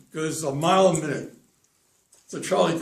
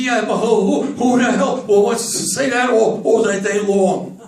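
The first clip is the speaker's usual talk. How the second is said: stuttering loudly, higher in pitch; loud exasperated stutter; 5 semitones higher, 10 dB louder than usual. Acted. Charlie objects to saying the tongue twister all day, loud exasperated stutter. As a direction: protest loudly, stumbling on words, dragging out the end.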